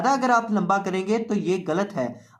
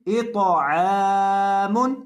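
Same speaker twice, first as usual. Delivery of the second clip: अश्तातन read very slowly with each syllable held; drawn-out reading; 4 dB louder, much slower than usual. Shown as a demonstration. Teacher showing students the whole word read slowly. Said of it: "teacher reads the whole word".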